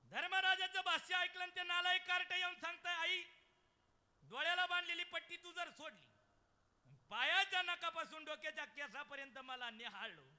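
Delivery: loud, high sermon cry; full-force preaching. Acted. Kirtankar telling the birth story, loud preaching. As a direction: preach it out to the back row.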